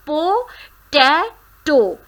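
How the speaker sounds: Po-ta-to spoken slowly, syllables split. Shown as a demonstration. Teacher splitting potato into syllables slowly. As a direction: break Po-ta-to into its three parts slowly. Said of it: "there are three syllables here".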